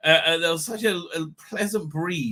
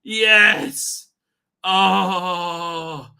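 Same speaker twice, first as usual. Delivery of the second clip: louder, eager cries of yes; mock delighted exclaim; 3 semitones higher, 8 dB louder, much slower than usual. Father answering his daughter-in-law's refusal, mock delighted. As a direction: gush with exaggerated joy. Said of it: "I'm like".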